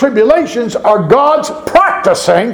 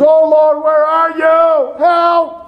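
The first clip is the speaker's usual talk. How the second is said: desperate cry; 6 semitones higher, slower than usual. Retold or imitated. Preacher mimics a desperate prayer, calling out. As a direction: call out to God in panic. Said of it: "Our prayer is".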